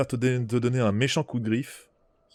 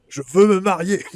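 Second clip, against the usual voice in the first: laughing joke; 6 semitones higher, 5 dB louder than usual. Real, not acted. Player jokes loudly, laughs.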